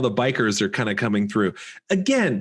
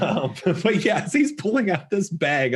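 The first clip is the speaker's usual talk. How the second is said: usual speaking voice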